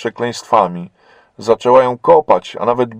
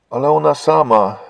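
usual speaking voice

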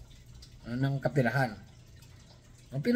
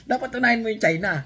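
louder and higher, laughing through it; real amused laughter; 6 semitones higher, 6 dB louder than usual. Real, not acted. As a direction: say it while still laughing. Joking advice to pastor.

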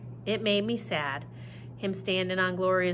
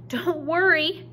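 high, loud, slow shout; 8 semitones higher, 4 dB louder, slower than usual. Acted. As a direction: call out reassurance over the storm. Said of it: "she kept shouting".

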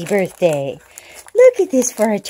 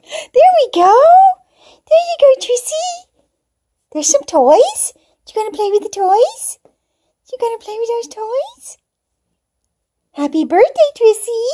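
squeaky sing-song coaxing for a kitten; pet talk; 11 semitones higher than usual. Real, not acted. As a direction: lure the cat to play, up high. Coaxing cat to play.

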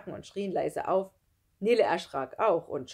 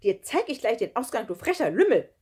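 loud, raised-pitch threat; indignant scolding; 5 semitones higher, 9 dB louder than usual. A narrator's reading. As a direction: tell off a cheeky rascal loudly. Adelheid tells off the ghost. (laut und empört schimpfend)

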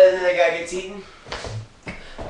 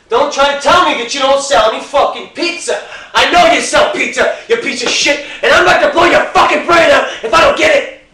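much higher and louder, all-out yell with a laugh; screaming threat; 9 semitones higher, 10 dB louder than usual. Acted. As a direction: scream it, losing all control. Customer threatens the manager.

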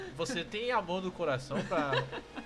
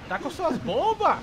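higher, louder, slower demand; insistent shout; 10 semitones higher, 8 dB louder, slower than usual. Real, not acted. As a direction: yell it at your teammate. Urging partner to bomb, repeated.

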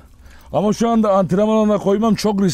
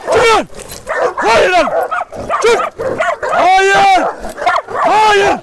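commanding loud shouts, much higher; sert bağırış; 12 semitones higher, 4 dB louder, much slower than usual. Real, not acted. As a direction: yell firm commands to stop the fighting dogs. Köpekleri kavgadan ayırmaya çalışıyor. (köpeklere sert, yüksek sesle bağırarak emir veriyor)

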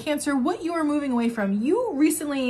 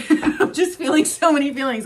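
laughing; 4 semitones higher, 7 dB louder than usual. Real, not acted. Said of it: "I can't even get my words out".

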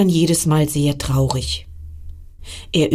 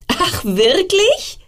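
laughing comets; 12 semitones higher, slower than usual. Acted. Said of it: "lachten die Kometen".

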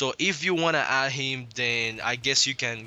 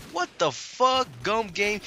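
mock surprise; 7 semitones higher, slower than usual. Mock surprise at own announcement.

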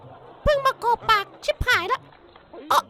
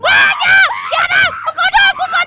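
panicked plea; 5 semitones higher, 7 dB louder than usual. Acted. Pleading shout.

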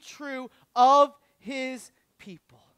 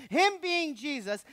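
usual speaking voice

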